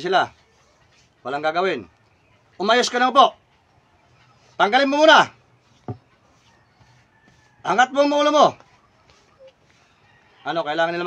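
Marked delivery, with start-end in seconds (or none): 2.6-8.5 high, loud, forceful orders; sharp command. Real, not acted. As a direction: order them to obey now. Orders possessed person to straighten up.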